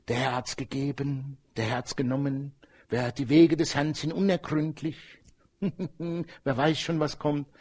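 mock resigned sigh.

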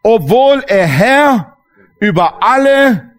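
preaching the verse to the church; slow declaiming. Acted. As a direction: proclaim it word by word. Preacher declaiming scripture slowly.

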